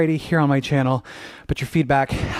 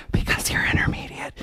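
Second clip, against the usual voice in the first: hushed, voiceless whisper; whispered aside; 7 dB quieter than usual. Pretend. Teacher's whispered aside to students.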